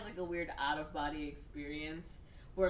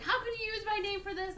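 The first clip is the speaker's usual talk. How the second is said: imitated indignation; 3 dB louder, faster than usual. Sister confronts brother over name.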